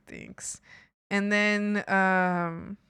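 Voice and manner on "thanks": breathy whispered thanks. Retold or imitated. She reenacts thanking the car guy, in a whisper. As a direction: mumble thanks under breath.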